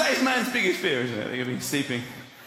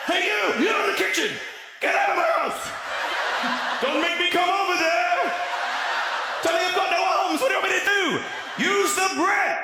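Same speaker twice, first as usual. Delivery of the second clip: shouting at burglar; 9 semitones higher than usual. Acted. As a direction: yell down the stairs to scare him off.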